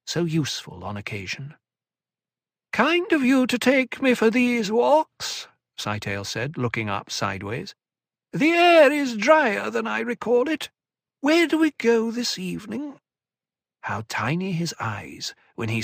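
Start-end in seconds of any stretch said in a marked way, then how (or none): 2.7-5.5 high, slow, wheedling little man; whining elfin voice. A narrator's reading. Tleilaxu Cytail's voiced lines. 8.3-13.0 whining elfin voice. A narrator's reading. Tleilaxu Cytail's voiced lines.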